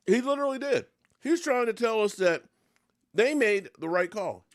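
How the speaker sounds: high, loud outrage; outraged rant. Real, not acted. Fan railing at the coach's excuse, outraged and loud. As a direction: blast the coach's excuse, pitch way up.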